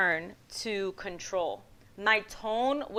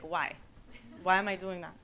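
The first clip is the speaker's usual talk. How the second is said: ordinary talk, nothing marked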